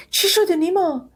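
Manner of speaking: high, slow, frightened question; alarmed. Acted. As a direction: ask in sudden fear. Daughter alarmed at father collapse. (پرسش ترسیده)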